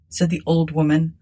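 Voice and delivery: plain reading voice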